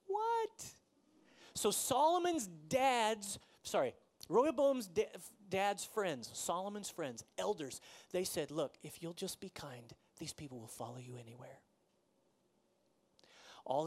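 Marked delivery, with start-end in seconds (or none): 0.1-0.7 high, sharp burst of disbelief; can't believe what he just read; shocked what. Real, not acted. Reacting to the harsh reply. 8.5-11.6 softly whispered plea to be kind; whispered advice. Acted. Elders' gentle advice quoted.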